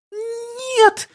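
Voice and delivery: put-on very high, thin little-girl cry; child's cry. A narrator's reading. Child clinging to his cheek, sudden high cry. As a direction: squeal it in a tiny voice. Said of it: "вдруг закричала она".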